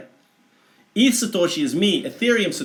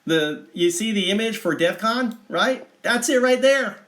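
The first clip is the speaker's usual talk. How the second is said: ordinary delivery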